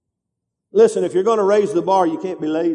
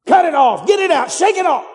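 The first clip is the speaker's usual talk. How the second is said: high rising shouted commands; preacher's shout; 8 semitones higher than usual. Real, not acted. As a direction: bark it out to throw it off.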